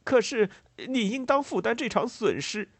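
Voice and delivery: high, aggrieved farmer's protest; gloomy anger. A narrator's reading. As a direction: demand it with heavy, heated resentment. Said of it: "带着沉郁的愤怒说道". (带着沉郁的愤怒高声抗议)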